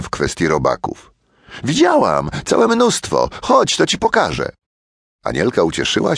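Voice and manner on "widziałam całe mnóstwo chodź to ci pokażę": put-on much higher, excited girl voice; bright child eager to show him; eager little girl. A narrator's reading.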